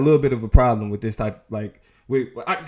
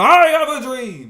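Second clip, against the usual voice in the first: mock preacher quote; 6 semitones higher, 5 dB louder, slower than usual. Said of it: "for Tucker Carlson to turn around".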